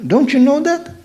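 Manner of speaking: high, light imitation of the ladies agreeing; ladies' high voice. Imitating the ladies who nod at his talk, high-pitched. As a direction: chirp it up high like the ladies nodding along. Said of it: "the ladies go".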